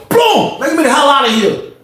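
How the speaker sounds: high-pitched door-kick boom and demand; retold frantic outburst; snapping. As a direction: burst out, demanding release. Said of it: "I just started snapping".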